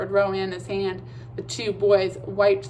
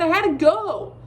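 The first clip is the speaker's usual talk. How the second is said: embarrassed repeat; 8 semitones higher, 5 dB louder than usual. A narrator's reading. Red-faced sigh.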